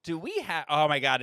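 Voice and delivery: usual speaking voice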